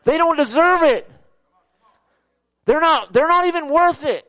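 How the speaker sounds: high, loud outraged angel; indignant protest. Acted. Gabriel keeps protesting against God's plan.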